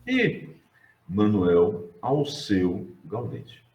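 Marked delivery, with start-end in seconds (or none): 1.1-3.6 Manuel Alceu Gaudêncio said slowly, each name spaced out; deliberate slow naming of Manuel Alceu Gaudêncio; slow careful naming. Shown as a demonstration. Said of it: "Não é Manuel Elceu Gaudêncio".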